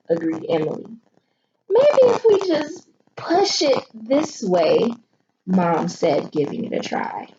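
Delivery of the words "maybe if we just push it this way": high mom voice. Acted.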